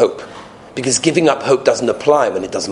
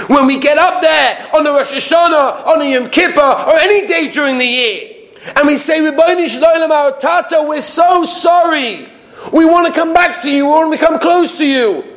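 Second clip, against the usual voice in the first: high, pleading sermon cry; impassioned preaching. Real, not acted. Rabbi voicing the congregation's apology to God, fervent preaching. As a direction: plead fervently to a father in heaven.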